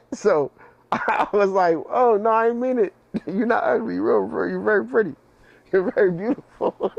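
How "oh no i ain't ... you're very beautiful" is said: higher pitch, laughing through the fake apology. Apologizing to the cousin he called ugly, laughing. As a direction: take it back while cracking up.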